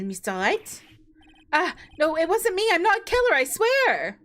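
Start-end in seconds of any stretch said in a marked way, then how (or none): 1.5-4.2 frantic, rising pleading; panicked denial. Acted. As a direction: blurt it out in a panic. Witness panics under questioning.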